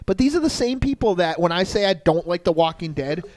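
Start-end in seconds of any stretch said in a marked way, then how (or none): none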